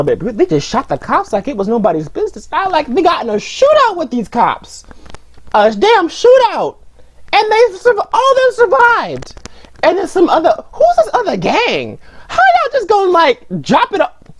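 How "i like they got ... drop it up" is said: high-pitched, incredulous complaint; mad ranting. Real, not acted. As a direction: let the pitch climb as you protest. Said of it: "I'm mad".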